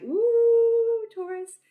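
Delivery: high, loud delighted ooh; excited. Real, not acted. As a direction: squeal with delight at the card. Excited reaction to card.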